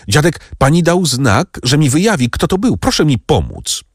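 high, breathless plea for help; eager pleading. A narrator's reading. Franz begs Valentin for help, urgently excited. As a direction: urge her to help now.

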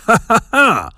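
high, hammed-up villain's cackle; director's triumphant demonic cackle; demonic laughter. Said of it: "wybuchnął demonicznym śmiechem".

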